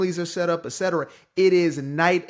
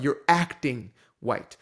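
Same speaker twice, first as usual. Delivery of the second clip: slow, with acting leaned on hard; stress on acting; 3 semitones lower, slower than usual. Shown as a demonstration. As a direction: hit the word acting.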